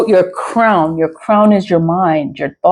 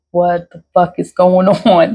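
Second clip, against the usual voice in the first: usual speaking voice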